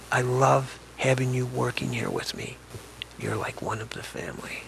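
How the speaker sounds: hushed, grateful quote of a loving employer; a quiet, tender echo of her voice. Retold or imitated. Retold kind words of elder.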